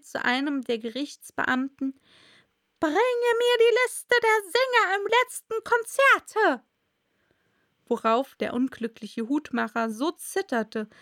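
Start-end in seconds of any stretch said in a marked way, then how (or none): 2.8-6.6 high, loud commanding queen; herrische Königin. Acted. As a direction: give a royal order at high pitch and full volume. Queen orders a court officer. (herrische, laute, hohe Königinnenstimme)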